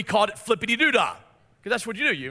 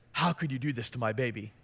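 much lower, much softer reproach; mama bear glare; 10 semitones lower, 9 dB quieter than usual. Retold or imitated. Mom picking up her son, wordless mama bear reproach, low and hushed. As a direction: voice the mom's silent accusation, low and hushed. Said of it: "that mama bear look".

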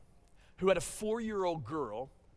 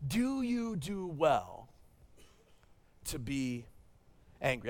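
repeated question of God; 4 semitones lower, slower than usual. Acted. Pastor repeating God's question to Jonah, slow with long pauses. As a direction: stretch it out and let it sink in.

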